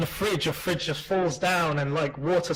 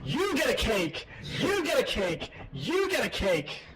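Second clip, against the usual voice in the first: high, slow talk-show giveaway holler; Oprah; 7 semitones higher, slower than usual. Acted. Suggesting a voice for the fridge, high Oprah impression. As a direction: mimic a host handing out prizes, drawn out. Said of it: "About, like, Oprah".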